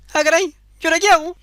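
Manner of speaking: put-on high, fast fretting girl; panicked girl. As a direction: fret it out quickly in a high voice.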